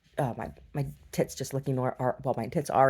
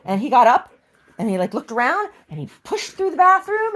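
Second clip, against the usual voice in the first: much higher and louder, bubbling over; excited pet talk; 13 semitones higher, 9 dB louder than usual. Real, not acted. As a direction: gush about the dog. Excited chat about the dog.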